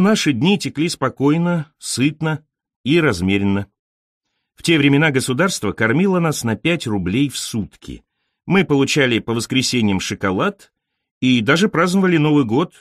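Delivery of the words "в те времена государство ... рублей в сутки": ordinary delivery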